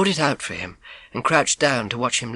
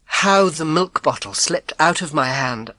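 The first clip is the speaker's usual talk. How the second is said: ordinary delivery, nothing marked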